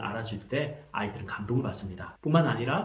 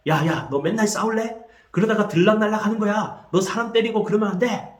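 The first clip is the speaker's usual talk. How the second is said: raised, higher-pitched mock scolding; scolding parent; 8 semitones higher, 5 dB louder than usual. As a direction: tell the kid off for fighting again. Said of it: "이렇게 해버리면".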